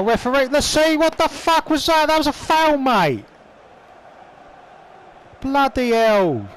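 outraged protest. Real, not acted. Gamer protesting a missed foul to the referee, outraged ranting. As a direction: rage at the referee over a foul.

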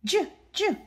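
high puppet voice; Osito. Pretend. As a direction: squeak the sound as a toy bear. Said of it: "¿Lo puedes decir, Osito?". (voz aguda de osito de juguete)

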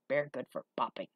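gruff, pleased hunchback; low broken speech with a touch of pride; rough-voiced Igor showing off; low gravelly voice. A narrator's reading. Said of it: "His voice was low and gravelly".

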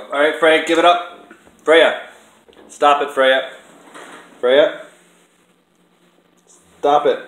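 loud, sharp scolding shout; real angry shout at a misbehaving pet; shouting at the cat. Real, not acted. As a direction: yell at the cat to stop. Scolding cat humping the other.

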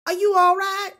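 high, loud Southern mom checking on her son. Acted. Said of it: "I don't know why she's suddenly Southern".

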